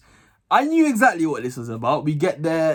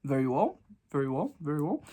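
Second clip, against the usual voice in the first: muted straight face; 3 semitones lower, 9 dB quieter than usual. Retold or imitated. Keeping straight face at the time.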